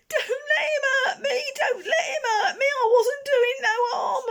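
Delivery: very high, loud, tearful pleading; wailing. Acted. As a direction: beg Barney to save him.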